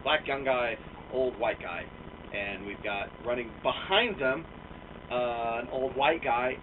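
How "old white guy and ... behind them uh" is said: normal talking voice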